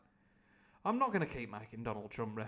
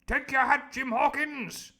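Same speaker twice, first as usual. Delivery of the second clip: high loud slow pompous command; the Squire; 11 semitones higher, 11 dB louder, slower than usual. Acted. The Squire inviting Jim aboard, high and loud. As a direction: order him grandly.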